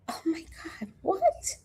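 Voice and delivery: high, soft gasped disbelief; breath-caught astonishment; real shock. Real, not acted. Reader startled by news about a book, hushed shock.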